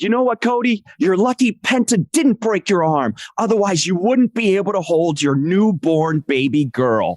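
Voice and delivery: high, emphatic wrestling promo; impassioned translator. Retold or imitated. Translator delivers the line that sparks Cody, high impassioned taunt. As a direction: imitate a promo taunt, starting high and slowing at the end. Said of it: "And I said".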